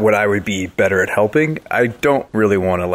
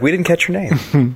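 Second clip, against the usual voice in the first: higher-pitched character line; in-character Tokus; 7 semitones higher than usual. Acted. Player quoting their character asking the name, pitched up. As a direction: lift the pitch for a put-on quote. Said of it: "but he's like".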